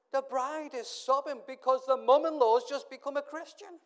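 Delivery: excited outburst. Real, not acted. Excited story of conversion.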